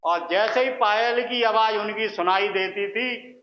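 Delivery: ordinary talk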